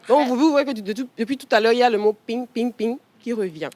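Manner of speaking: normal talking voice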